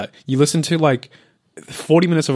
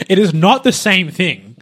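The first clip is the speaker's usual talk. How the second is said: loud, raised mock protest; mock indignant; 7 semitones higher, 6 dB louder than usual. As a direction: argue it loudly in jest. Mock argument over colour.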